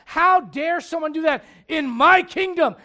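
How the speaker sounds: loud, high, slow outrage; righteous indignation. Acted. David outraged at wrong in his kingdom, righteous indignation. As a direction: thunder like an offended king. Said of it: "what you think is righteous indignation".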